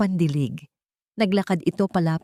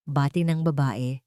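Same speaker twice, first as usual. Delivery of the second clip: ordinary delivery, nothing marked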